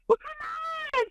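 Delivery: very high mock coaxing voice; coaxing friend voice. The friend urging her to touch, high mock coaxing.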